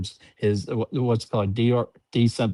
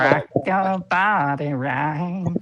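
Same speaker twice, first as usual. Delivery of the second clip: slow, higher put-on voice; slow mock rant, pitched up; ranting dictator speech; 4 semitones higher, much slower than usual. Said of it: "in Tyrant Beware's voice".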